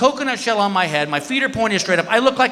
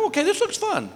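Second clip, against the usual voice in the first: eager approval; 12 semitones higher, 6 dB quieter than usual. Retold or imitated. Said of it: "I go".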